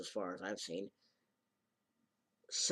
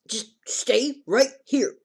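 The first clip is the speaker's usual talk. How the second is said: high insistent order; 11 semitones higher, 11 dB louder, slower than usual. Retold or imitated. Girl orders him to stay.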